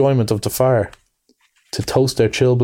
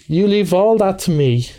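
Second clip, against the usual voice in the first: raised, assured take-charge voice; Rat, masterful; 6 semitones higher than usual. A narrator's reading. Rat taking over from his worried friend, masterful. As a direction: take command with easy confidence. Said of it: "said the masterful Rat".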